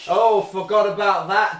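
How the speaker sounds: ordinary talk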